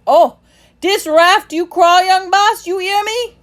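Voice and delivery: high, loud, slow angry command; thick with anger. A narrator's reading. Timothy ordering the boy to stay on the raft, angry. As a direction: lay down the law furiously. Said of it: "his voice was thick with anger".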